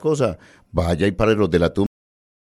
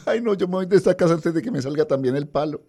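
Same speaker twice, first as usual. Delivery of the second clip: put-on high-pitched tearful servant girl, softer; crying country girl; 9 semitones higher, 4 dB quieter than usual. Host telling a joke, voicing the servant girl upset to her boss, high and tearful. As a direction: whimper it to the boss like a naive scared girl.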